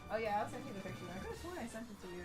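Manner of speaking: ordinary talk, nothing marked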